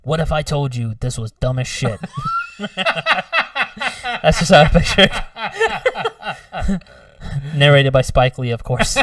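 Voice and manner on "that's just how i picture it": words through real laughter; loud laughing delivery. Real, not acted. Laughing at own joke.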